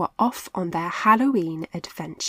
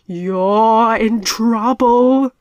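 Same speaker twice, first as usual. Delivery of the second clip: slow, much louder ghost moan; ghostly voice; 3 semitones higher, 11 dB louder, much slower than usual. A narrator's reading. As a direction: moan the threat. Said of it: "another ghostly voice spoke".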